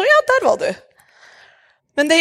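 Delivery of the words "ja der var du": mock delight. Imagined meeting someone seen.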